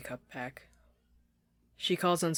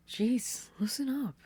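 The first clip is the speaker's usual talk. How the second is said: exasperated friend; 10 semitones higher, 4 dB louder, slower than usual. Acted. Sasha urging Anne to relax.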